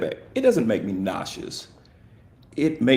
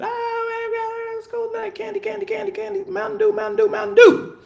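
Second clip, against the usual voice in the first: put-on high shrieking kid voice, louder at the end; screaming kids; 4 dB louder than usual. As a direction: shriek like hyper kids after school. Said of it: "The kids come in, they gr-, screaming".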